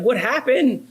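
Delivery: a lightly played worried parent; mock alarmed parent. Parents asking crying daughter.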